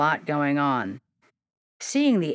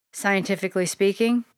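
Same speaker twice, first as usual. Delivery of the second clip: usual speaking voice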